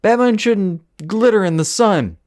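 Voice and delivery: high-pitched mocking quote; mocking imitation. Mocking someone's silly objection.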